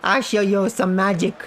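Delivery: high mischievous leprechaun. Pretend. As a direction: tease like a sly leprechaun. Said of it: "I am a leprechaun".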